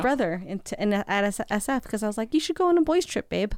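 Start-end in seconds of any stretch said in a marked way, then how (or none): 2.3-3.5 lifted, coaxing suggestion to her man; a higher, sweet-talking nudge. Retold or imitated. Wife urging her husband to take a trip, sweetly raised.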